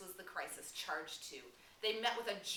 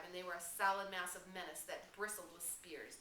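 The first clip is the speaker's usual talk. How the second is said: plain reading voice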